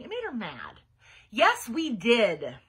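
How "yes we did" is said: slow, loud, angry insistence; yelled. A narrator's reading. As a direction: hammer each word out loudly. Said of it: "she yelled".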